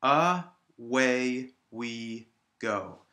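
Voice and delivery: very slow "Away we go", a gap after each syllable; separated syllables. Shown as a demonstration. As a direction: break "Away we go" into A, WAY, WE, GO. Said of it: "separate all of the syllables".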